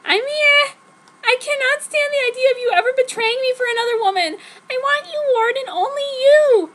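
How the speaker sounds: fast weepy melodramatic begging; tearful dramatic heroine. Acted. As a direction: blurt it out near tears.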